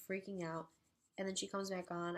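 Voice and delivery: normal talking voice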